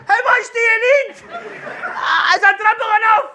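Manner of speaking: very high, loud desperate scream. Retold or imitated. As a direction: beg the nurses at full volume. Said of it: "standing screaming".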